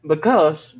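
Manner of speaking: ordinary delivery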